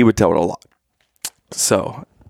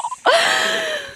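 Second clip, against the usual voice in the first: high, wheezing laugh; helpless laughter. Real, not acted. Joker after his own moose punchline, wheezing laughter. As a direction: crack up at your own joke.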